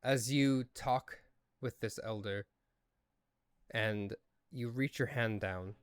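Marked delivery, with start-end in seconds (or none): none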